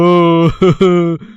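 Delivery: sneaky giggle behind the fake tears; stifled snicker. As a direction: snicker under the breath. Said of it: "रोने का नाटक करता है।".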